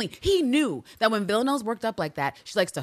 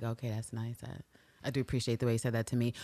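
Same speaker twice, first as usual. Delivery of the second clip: deadpan low mumble; 9 semitones lower, 9 dB quieter, faster than usual. Retold or imitated. Voicing deadpan Eve.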